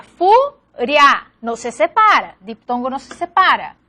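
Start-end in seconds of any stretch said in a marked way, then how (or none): 0.0-1.3 "furia" as fu, pause, ria; "furia" broken after fu, keeping ria together; syllable division. Shown as a demonstration. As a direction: split "furia" into two clear syllables. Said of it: "Aquí también sería".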